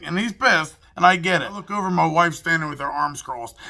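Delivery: usual speaking voice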